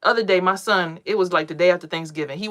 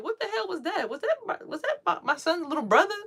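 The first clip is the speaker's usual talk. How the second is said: startled; 12 semitones higher, 4 dB quieter than usual. Retold or imitated. Startled by scream while meditating.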